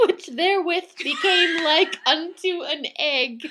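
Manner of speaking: high, loud, laughing recital. Real, not acted. Reader relishing the line with friend, laughing. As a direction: repeat the line through real laughter.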